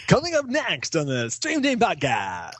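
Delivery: slow, stretched-out showy announcer call; mock announcer. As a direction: swoop up high and draw out the words. Parodies an announcer for next episode.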